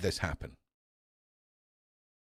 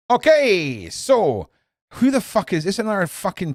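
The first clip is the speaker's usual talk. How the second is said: 1 outraged outburst; 7 semitones higher, 5 dB louder than usual. Real, not acted. Outraged reaction to news headline.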